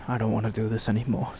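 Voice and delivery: low, soft and fast, a touch of mock despair; mock defeated. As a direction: sigh it out like you're done. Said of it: "loses his accent".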